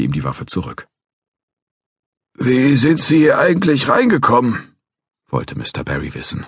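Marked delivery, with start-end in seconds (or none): 2.3-4.8 put-on a cranky old guard threatening to call police; old watchman.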